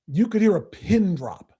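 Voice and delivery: ordinary delivery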